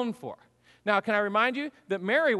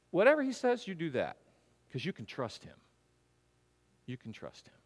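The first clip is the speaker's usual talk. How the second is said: fading to a much softer hush; tender reassurance; 4 semitones lower, 4 dB quieter than usual. Retold or imitated. Mary telling the servants to trust Jesus, fading soft. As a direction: reassure them, letting it drop softly away.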